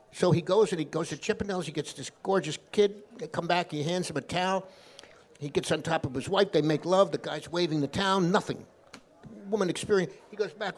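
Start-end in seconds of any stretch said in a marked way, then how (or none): none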